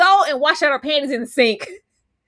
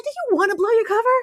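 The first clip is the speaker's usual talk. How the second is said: exasperated scolding; 12 semitones higher than usual. Retold or imitated. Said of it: "I said".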